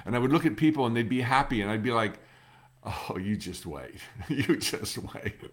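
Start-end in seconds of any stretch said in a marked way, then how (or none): 2.8-5.4 bitter warning. Retold or imitated. Self quoted, bitter at happy people.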